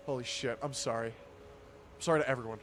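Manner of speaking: usual speaking voice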